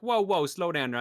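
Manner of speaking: lightly imitating a Dan Aykroyd New York accent; a quick mock New York "whoa, whoa". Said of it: "like, a, a New York accent".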